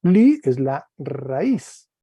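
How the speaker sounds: slow, Li held and stressed; teacher stressing the root. Shown as a demonstration. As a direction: lean on Li, slowly. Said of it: "en todas las formas del verbo".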